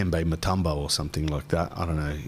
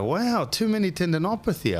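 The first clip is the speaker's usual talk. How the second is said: higher mock northern lady; imitating nurse; 10 semitones higher than usual. Ultrasound lady examining elbow, higher mock voice. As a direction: play the ultrasound lady, pitched up. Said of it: "she goes".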